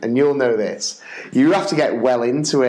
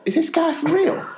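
incredulous; 13 semitones higher than usual. Retold or imitated. Dad's incredulous thought.

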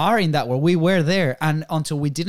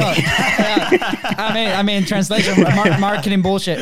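higher pitched, laughing through it; 5 semitones higher, 4 dB louder, slower than usual. Real, not acted. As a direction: laugh as you spell it out. Laughing while spelling out acronym.

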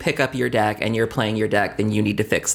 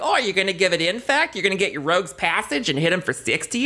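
higher-pitched imitation of eager onlookers; mock excited players; 8 semitones higher than usual. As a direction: egg the player on, imitating the crowd. Mimics players egging him on.

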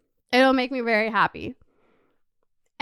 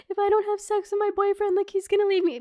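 crying; 8 semitones higher than usual. Retold or imitated. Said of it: "I used to cry my eyes out".

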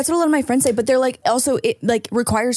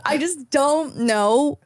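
ordinary talk, nothing marked

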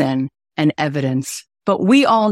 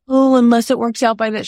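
higher, louder, a mocking half-hearted maybe; imitating someone who keeps a way out, raised and louder; mock hedging; 6 semitones higher, 4 dB louder than usual. Telling a friend she won't hedge, mocking hedging voice. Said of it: "And I'm not giving it like".

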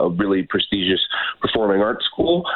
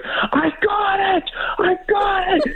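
very high, drawn-out mock scream; high celebratory cry, not at full volume; triumphant yell; slower than usual. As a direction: re-enact shrieking the good news slowly. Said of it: "and I yelled, I was like".

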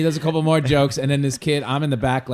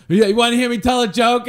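put-on high, loud kid voice; a kid back for more; eager kid; 10 semitones higher, 7 dB louder than usual. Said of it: "the kid goes up and goes".

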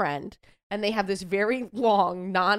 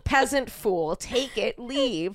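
higher-pitched, hammed-up imperious star; mock haughty dismissal; 5 semitones higher, 4 dB louder, slower than usual. Star shooing a fan out of line, mock haughty. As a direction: dismiss them like a lowly subject.

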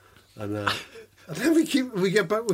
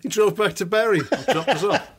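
after laughing hard; 6 semitones higher than usual. Real, not acted. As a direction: still catching breath from laughing. Right after a long laugh.

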